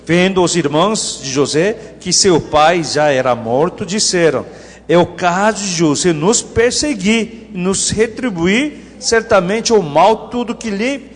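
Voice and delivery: loud, measured Bible reading aloud; declaimed scripture, projected to the congregation. Acted. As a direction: read the verse out for all to follow. Congregation reads verse aloud together.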